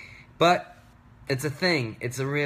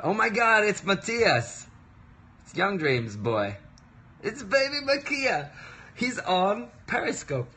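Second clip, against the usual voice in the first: excited; 10 semitones higher, 3 dB louder than usual. Real, not acted. Excited to see friend online.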